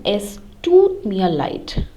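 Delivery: "tut" drawn out with a long vowel. Shown as a demonstration. Teacher showing learners the apology, long stressed "tut". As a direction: hold the vowel in "tut", slowly. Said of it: "the tut has to be long".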